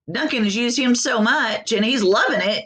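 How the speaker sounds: plain reading voice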